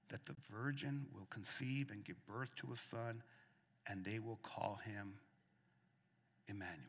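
soft, low, intimate sermon voice; hushed preaching. Real, not acted. Pastor comforting listeners in the dark, hushed. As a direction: bring the room in close and quiet.

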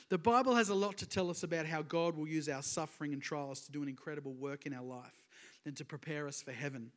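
ordinary talk

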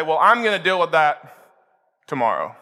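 procrastinator's offhand excuse; lazy shrug. Retold or imitated. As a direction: brush it off to tomorrow. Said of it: "they'll say".

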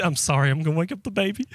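ordinary talk, nothing marked

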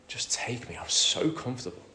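whispered, hopeless plea; despairing whisper. Retold or imitated. Preacher voicing Jonah, whispering his wish to die. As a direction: breathe it out like you've given up.

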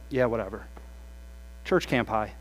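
low, dismissive shrug; bored shrug. Retold or imitated. Student weeks after camp, low and indifferent. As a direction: toss it off like it never mattered.

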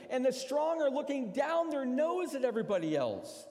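high, loud, driving sermon delivery; full-force preaching. Real, not acted. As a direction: drive each point home to the whole congregation.

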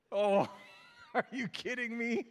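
high, laughing swoon; delighted disbelief. Real, not acted. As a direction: melt with a helpless laugh. Melting at baby daughter's photo.

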